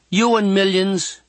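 put-on high girlish put-down; teasing girl. A narrator's reading. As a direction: tease him in a high girl's voice. Girl teases boy.

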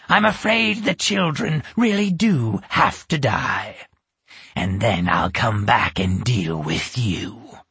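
menacing witch; lightly voiced cruel witch. A narrator's reading. Witch threatens the children.

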